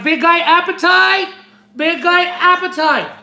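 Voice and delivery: mock counter call, pitched high; calling out a name. Imagining clerks calling out the channel name, high mock announcing.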